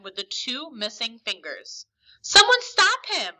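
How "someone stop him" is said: high, loud drawn-out call for help; crying out. A narrator's reading. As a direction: raise the alarm, loud and high. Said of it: "I cried out".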